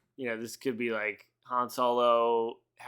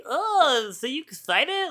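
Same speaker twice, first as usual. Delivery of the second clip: much higher, fake-peppy question; mock eager interviewer; 12 semitones higher, slower than usual. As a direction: play a hyped-up host fishing for excitement. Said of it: "this fucking dweeb is like".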